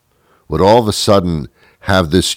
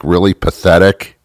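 ordinary delivery

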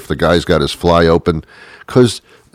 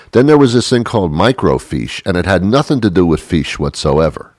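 ordinary talk, nothing marked

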